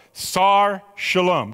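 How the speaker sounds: "Sar Shalom" slow, stress on SAR, falling; slow teaching demo. Shown as a demonstration. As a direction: spell out "Sar Shalom" slowly for the class. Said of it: "Can you say it with me?".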